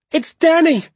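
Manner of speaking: high, loud desperate call; shouting for Dad. A narrator's reading. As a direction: shout through the dark for a lost father. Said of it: "I shouted".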